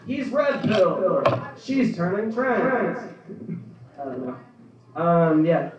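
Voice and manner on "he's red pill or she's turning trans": announcer hype on silly lines; sports announcer. Retold or imitated. Comic imagining custom announcer lines, hype call. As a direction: call it like a big play. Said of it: "you could just make it".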